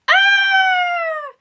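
high, loud drawn-out "aah" scream; storybook princess screaming as she falls. A narrator's reading. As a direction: let out a long, sustained high shriek. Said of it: "Peepers screamed".

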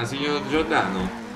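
ordinary talk, nothing marked

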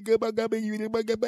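very high, shrill cartoonish cursing yell; yelling bus driver. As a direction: squawk it like a furious bus driver. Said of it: "the bus driver gets up".

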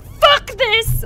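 a high, loud mock blow-up; mock angry outburst. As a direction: snap like a villain losing it, half in fun. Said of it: "I'm like".

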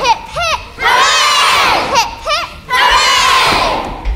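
slow, loud group cheer; hooray cheer. Acted. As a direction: shout it out in celebration. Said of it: "shouted his hip hip hooray".

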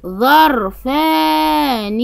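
ظرفان drawn out slowly; slow model word. Shown as a demonstration. Teacher sounding out ظرفان for children. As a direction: stretch ظرفان for the class to repeat. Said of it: "أنطق".

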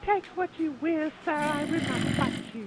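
high, loud, laughing coy offer; high flirty voice. Pretend. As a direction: offer yourself with a giggle.

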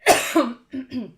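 hard loud cough; real cough bursting out; chesty cough. Real, not acted. Sick streamer coughing.

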